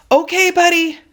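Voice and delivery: high, loud coaxing shout. Acted. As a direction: call down to cheer up a scared dog. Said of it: "I shouted down to his terrified face".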